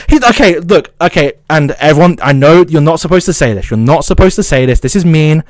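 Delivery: ordinary talk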